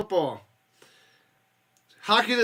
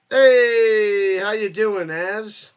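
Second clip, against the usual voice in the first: Fonzie impression; 3 semitones higher, 8 dB louder, much slower than usual. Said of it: "What is he, Fonzie".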